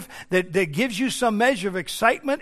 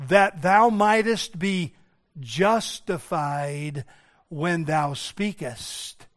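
measured scripture quotation; much slower than usual. Retold or imitated. Preacher quotes the psalmist to congregation, slow measured recital. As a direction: recite it slowly, letting each phrase settle.

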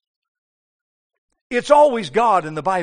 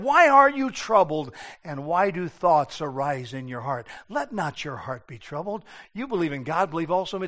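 soft, lower, comforting Jesus; gentle reassurance; 5 semitones lower, 5 dB quieter than usual. Retold or imitated. Jesus quietly comforting fearful disciples, a hint of softer voice. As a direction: soothe the frightened disciples. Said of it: "He said".

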